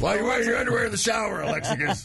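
high, fast mock shout; badgering old coach holler; gym teacher. Host as gym teacher scolding young Alexakis, hammed-up coach shout. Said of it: "or old gym teacher".

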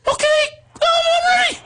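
extremely high falsetto shout; squeaky eager boy. Retold or imitated. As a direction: squeal it like an overeager rookie. His boy self answers the coach.

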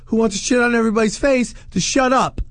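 usual speaking voice

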